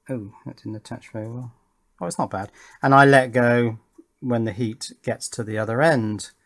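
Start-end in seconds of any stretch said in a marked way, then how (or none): none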